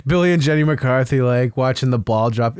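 ordinary talk

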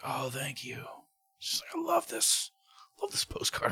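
high, soft mock gushing; hammed-up adoring coo; gushing fan. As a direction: imitate an overjoyed fan in a thin, high voice. Woman gushing over a handed postcard.

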